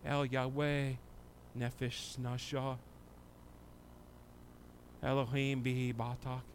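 soft, low prayer voice with long pauses; hushed prayer. Acted. Pastor praying with the congregation, soft and hushed. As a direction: pray it quietly to God.

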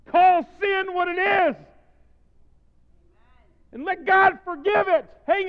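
sustained high shouted preaching; full-force preaching. Real, not acted. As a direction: thunder it out to the whole congregation.

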